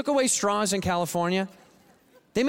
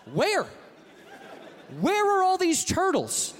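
incredulous shout; 10 semitones higher than usual. Acted. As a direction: demand it, voice shooting up. Incredulous comic rant.

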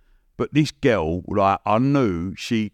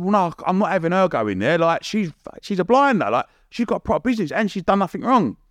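higher, pleading insistence; retold insistence; 8 semitones higher than usual. Defending Pamela to the producers, higher insistent. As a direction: argue her case, pitched up. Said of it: "I said".

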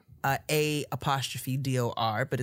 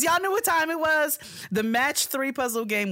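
high, loud playful hype; hyped-up; 12 semitones higher, 3 dB louder than usual. Pretend. Host springing the sponsor on listeners, playfully hyped.

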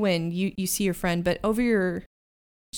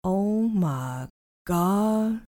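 put-on southern accent; much slower than usual. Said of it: "this voice from around, uh, the corner".

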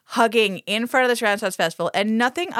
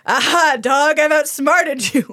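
loud, higher, drawn-out villainous boast; hammed-up triumphant schemer; gloating villain; 5 semitones higher, 5 dB louder, slower than usual. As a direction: crow over a beaten foe. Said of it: "rubbing his hands together like".

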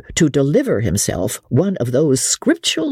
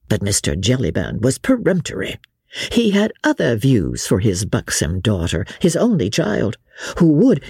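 plain reading voice

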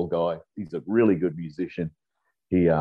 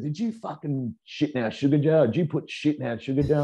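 higher, indignant accusing questions; mock accusation; 7 semitones higher than usual. Roommates accusing him over the sugar jar, high indignant mock accusation. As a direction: imitate the angry roommates confronting you. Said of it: "They said".

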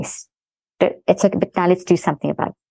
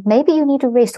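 lightly voiced child's suggestion, higher; imitating kids; 7 semitones higher than usual. Kids advising their tired mother, lightly imitated in a higher voice. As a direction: go up in pitch, as a kid might. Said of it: "And they'll say".

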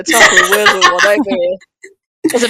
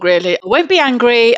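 normal talking voice

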